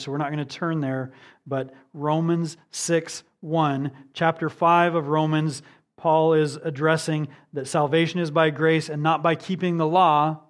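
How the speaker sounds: usual speaking voice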